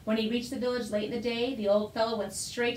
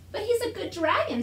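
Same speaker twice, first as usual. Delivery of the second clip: put-on high pleading child voice; pleading boy; 9 semitones higher, 3 dB louder than usual. A narrator's reading. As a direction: beg like a young boy.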